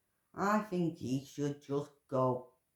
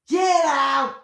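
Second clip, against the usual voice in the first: high, loud furious yell; angry shout; 12 semitones higher, 15 dB louder than usual. Real, not acted. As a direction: throw him out with your voice. Furious yelling at King Charles.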